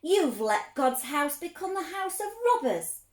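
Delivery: righteous anger thrown across the temple; angry shout. A narrator's reading. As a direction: roar it at the cheating traders. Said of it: "Jesus shouted".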